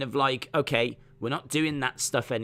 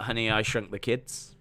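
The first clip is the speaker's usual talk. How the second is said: normal talking voice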